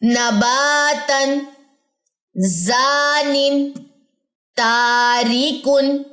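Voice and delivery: each word drawn out slowly with the mouth open; slow recitation. Shown as a demonstration. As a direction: model every word slowly for the class. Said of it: "پڑھیے میرے ساتھ". (آہستہ آہستہ تجوید کے ساتھ پڑھنا)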